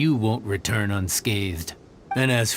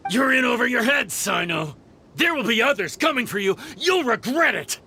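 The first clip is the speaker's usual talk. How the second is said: angry shout; 10 semitones higher than usual. Acted. Beaten mercenary yells while fleeing.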